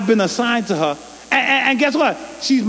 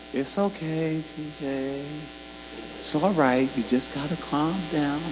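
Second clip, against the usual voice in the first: soothing; 6 semitones lower, 9 dB quieter than usual. Retold or imitated. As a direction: gently talk a child down. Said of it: "Larry be like".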